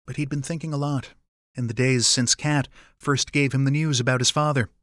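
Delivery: plain reading voice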